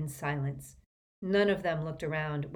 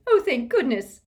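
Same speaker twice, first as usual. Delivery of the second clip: high, loud tearful relief; sobbing relief; 8 semitones higher, 10 dB louder than usual. A narrator's reading. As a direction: cry it out, overcome with relief. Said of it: "sobbed Missus Weasley".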